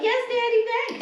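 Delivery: high mocking coo of a submissive girlfriend; mock breathy submissive. Podcaster mocking a reality star, cooing high like a submissive girlfriend. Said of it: "you can be like".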